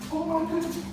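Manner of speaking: very high, gushing excitement; high-pitched excited praise; high energy. Shown as a demonstration. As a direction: squeal it at the dog, all energy. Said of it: "look, high energy".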